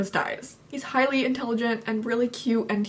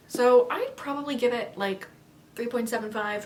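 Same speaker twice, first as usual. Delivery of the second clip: ordinary delivery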